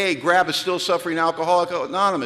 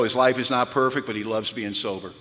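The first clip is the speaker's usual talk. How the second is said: ordinary talk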